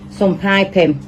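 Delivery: ordinary talk, nothing marked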